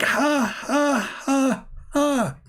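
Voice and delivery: high, cackling devil laugh in a voice not his own; devil's mocking laugh. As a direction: laugh like Satan mocking the one who yawns. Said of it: "And he's laughing like".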